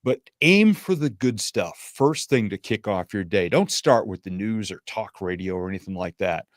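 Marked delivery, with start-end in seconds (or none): none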